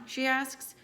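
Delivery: usual speaking voice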